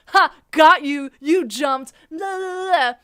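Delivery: loud, mocking imitation of a taunt; gloating scarer. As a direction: crow it, ending in singsong la-las. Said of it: "comes from behind to the front and goes".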